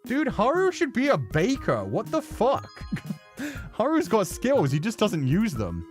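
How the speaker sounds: usual speaking voice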